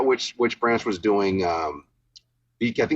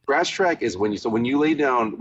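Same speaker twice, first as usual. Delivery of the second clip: ordinary talk, nothing marked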